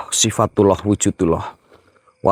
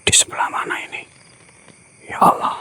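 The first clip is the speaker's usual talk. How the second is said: tense hushed whisper; 8 dB quieter, faster than usual. Real, not acted. Ghost hunter hearing a sound to his audience, tense whisper. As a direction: whisper as if listening hard in the dark.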